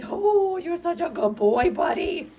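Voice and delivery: high, sing-song praise for a pet; talking to a dog. Real, not acted. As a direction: gush over a good puppy. Praising dogs.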